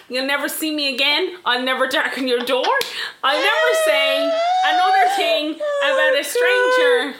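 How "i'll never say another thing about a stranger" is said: mock wail. Mock despairing apology.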